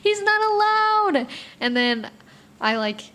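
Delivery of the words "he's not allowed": high, drawn-out mock whine; hammed-up protest, slow and loud; retold tantrum. Throwing a fit over Pietro moving in, mock-whiny. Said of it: "I was throwing this big fit".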